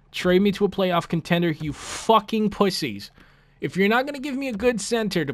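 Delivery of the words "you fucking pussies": ordinary talk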